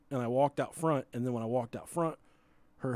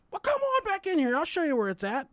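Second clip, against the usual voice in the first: high, eager salesman voice, pitched way up; an overly helpful clerk pitching it high; eager shop clerk; 14 semitones higher than usual. Retold or imitated. Cigar clerk inviting the teller back in, high and eager.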